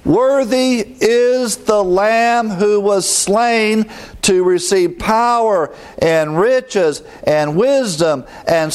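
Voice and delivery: high, slow, ringing proclamation; slow, lifted-up heavenly proclamation; proclaiming loudly. Retold or imitated. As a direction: call it out like a heavenly choir. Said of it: "saying with a loud voice".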